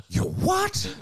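very high-pitched, squealed question; hammed-up shriek of a scandalised friend; shocked disbelief. Acting the friend's shocked reply.

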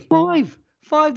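high, disbelieving repeat; incredulous. Real, not acted. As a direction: say it like you can't believe it. Shocked repeating of price.

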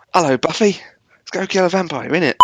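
high cockney-style Giles voice; hammed-up London accent; British accent. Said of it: "if Giles had been like that".

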